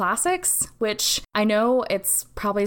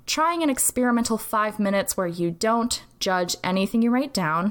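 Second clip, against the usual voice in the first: ordinary talk, nothing marked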